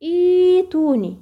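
إيتوني recited with long ii madd; tajwid recitation. Shown as a demonstration. Teacher recites إيتوني as an example for students. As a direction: chant إيتوني, drawing out the opening ii. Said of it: "مثلًا كلمة".

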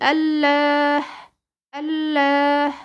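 الله drawn out very slowly with a heavy, full lam; recited Allah. Shown as a demonstration. Teacher modelling the word for her student, slow heavy recitation. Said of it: "بقى عندك إيه؟".

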